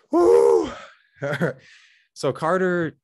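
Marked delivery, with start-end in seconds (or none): 0.1-0.7 loud high woo of excitement; excited whoop. Real, not acted. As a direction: let out a hyped whoop. Hyped whoop after intro.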